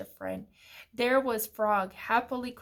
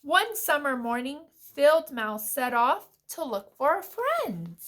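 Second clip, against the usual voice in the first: put-on animated read-aloud for young children; story narration; 4 semitones higher, 5 dB louder than usual. A narrator's reading. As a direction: read a picture book with lilting, clear emphasis.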